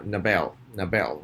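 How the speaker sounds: Nobel said as no-BEL, stress on the second syllable; model word for repeating. Shown as a demonstration. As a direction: clear teacher model: no-BEL. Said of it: "where is schwa? First syllable".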